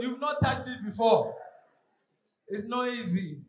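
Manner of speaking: ordinary delivery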